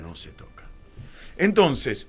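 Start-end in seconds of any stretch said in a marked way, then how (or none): 0.0-0.6 hushed conspiratorial whisper, barely voiced; whispered secret. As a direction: breathe it like a secret no one may hear. (susurrado cómplice)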